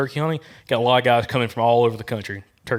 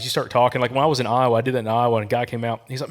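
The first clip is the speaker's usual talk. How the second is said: ordinary delivery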